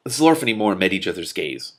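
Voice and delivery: ordinary delivery, nothing marked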